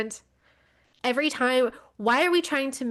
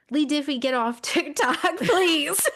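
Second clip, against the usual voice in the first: laughing plea; 7 semitones higher, 4 dB louder than usual. Real, not acted. Pleading with commentator, laughing.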